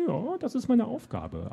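high, slow, self-satisfied; puffed-up little dream master; swelling pride. A narrator's reading. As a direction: draw it out proudly on a high note. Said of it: "schwoll vor Stolz an".

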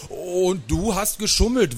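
high, emphatic repeat; indignant child's counter-accusation; insistent accusation. A narrator's reading. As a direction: insist on it, pitched well up.

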